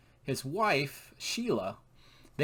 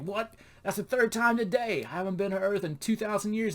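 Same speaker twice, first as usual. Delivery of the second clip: high-pitched mock outrage; hammed-up fed-up complaint; exasperated; 8 semitones higher than usual. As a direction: gripe incredulously, voice jumping up. God exasperated at being paged.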